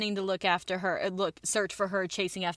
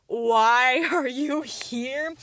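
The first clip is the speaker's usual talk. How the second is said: very slow, louder, drawn-out question; baffled questioning; 3 semitones higher, 5 dB louder, much slower than usual. Retold or imitated. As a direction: stretch every word in mock bafflement. Method baffled by the visitor.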